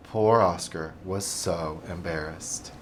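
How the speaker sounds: sympathetic. A narrator's reading. Narrator's sympathy.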